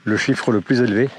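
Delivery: ordinary talk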